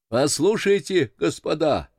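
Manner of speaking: high-pitched sailor's hail; voice from schooner. A narrator's reading. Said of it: "продолжал голос со шхуны". (высокий голос моряка со шхуны)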